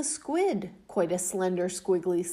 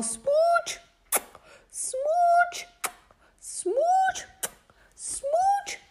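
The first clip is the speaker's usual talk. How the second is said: very high, loud kissy smooch; playful kissing fish; high smooches; 4 dB louder, much slower than usual. Acted. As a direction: squeak each smooch up high.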